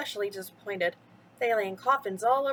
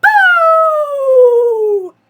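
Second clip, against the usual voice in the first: high, loud screeching boo; 17 dB louder than usual. Acted. Sally sneaks behind Jack and screeches a loud boo. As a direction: screech it to startle him. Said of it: "she screeched".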